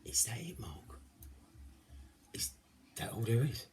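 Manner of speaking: hushed, halting mock disbelief; imitated underwhelmed viewer. Viewer questioning Mark about the recipe, imitated in a hushed, underwhelmed voice. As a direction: softly voice an unimpressed viewer, with long pauses. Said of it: "are probably sitting there going now".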